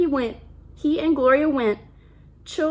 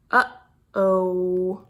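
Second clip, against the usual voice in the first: low, drawn-out "Uh-oh"; ominous uh-oh; 6 semitones lower than usual. Acted. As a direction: let the trouble hang in a low voice. Reader foreshadows trouble.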